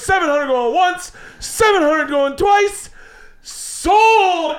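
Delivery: auctioneer call. Retold or imitated. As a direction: slam down the sale. Auctioneer closing sale.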